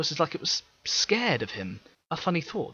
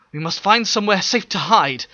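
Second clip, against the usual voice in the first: higher, louder pressing tone; urgent; 8 semitones higher, 9 dB louder than usual. Acted. Teppel telling Pera to hide, urgent. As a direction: press her to hide now. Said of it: "Teppel said urgently".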